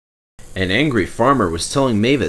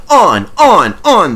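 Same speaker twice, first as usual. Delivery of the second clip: loud shouting trucks; yelling; 9 semitones higher, 9 dB louder, slower than usual. A narrator's reading. The trucks yelling as they bump Toby, loud. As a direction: yell it out wildly. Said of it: "they yelled".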